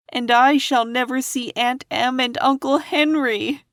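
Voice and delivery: put-on high, wistful girl on the edge of crying; Dorothy near tears. A narrator's reading. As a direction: let it rise toward tears. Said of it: "beginning to cry".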